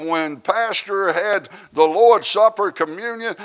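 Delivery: normal talking voice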